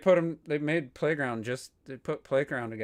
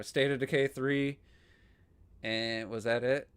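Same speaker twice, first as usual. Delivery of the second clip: ordinary delivery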